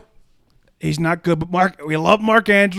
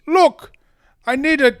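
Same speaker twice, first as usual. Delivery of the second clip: attempted African accent; 11 semitones higher, 4 dB louder than usual. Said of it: "I can't do an African accent".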